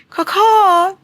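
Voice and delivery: high, loud hawk caw; imitated bird squawk; hawk cry. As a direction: screech like a bird flying off. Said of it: "'cause he's a hawk".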